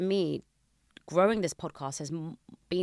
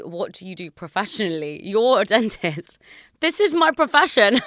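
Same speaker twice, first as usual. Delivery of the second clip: amused mock outrage; 4 semitones higher, 10 dB louder than usual. Laughing, reading out the question.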